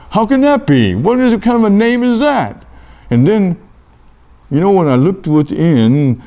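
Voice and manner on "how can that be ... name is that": high, incredulous questioning; baffled disbelief. Acted. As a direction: let the pitch leap in acted bafflement. Acted baffled reaction to the name.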